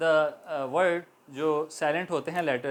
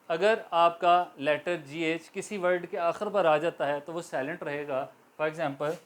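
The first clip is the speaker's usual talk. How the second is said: ordinary delivery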